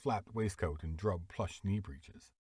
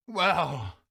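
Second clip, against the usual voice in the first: loud, much higher exclamation; exclaimed; 9 semitones higher, 8 dB louder than usual. Acted. Beadle exclaiming at the crying boy, indignant. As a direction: burst out indignantly. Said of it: "exclaimed Mister Bumble".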